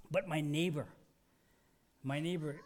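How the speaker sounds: normal talking voice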